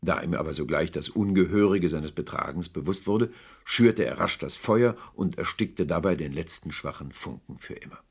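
ordinary delivery

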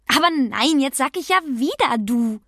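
gespieltes Entsetzen, höher und lauter; entsetzter Ausruf. A narrator's reading. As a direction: rufe erschrocken über den eigenen Fehler. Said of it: "schrie sie entsetzt". (entsetzt aufschreiend, leicht gespielt)

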